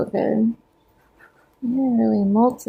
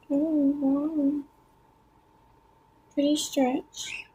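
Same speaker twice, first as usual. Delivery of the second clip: ordinary delivery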